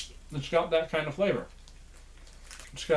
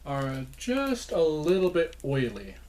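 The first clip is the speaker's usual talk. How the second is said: ordinary talk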